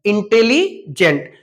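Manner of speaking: इंटेलिजेंट broken as इंटेली, pause, जेंट; इंटेली... जेंट, with a clear gap before जेंट; split in two. Shown as a demonstration. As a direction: say इंटेलिजेंट in two separate chunks. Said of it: "दो बार हम लोग pronunciation कर रहे हैं".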